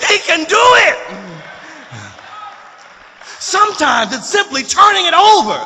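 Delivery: loud, high, building sermon climax; impassioned preacher's shout; preaching at full force. Real, not acted. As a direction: drive it home to the congregation, shouting the key words.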